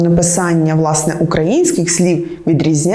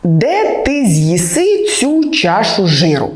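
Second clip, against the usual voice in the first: slow mnemonic; slower than usual. Shown as a demonstration. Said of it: "Запам'ятайте фразу".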